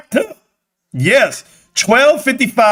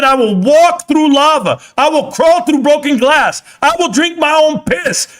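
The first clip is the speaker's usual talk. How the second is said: fired-up quote; 5 semitones higher than usual. Retold or imitated. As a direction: declare it like a rallying cry. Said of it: "I said".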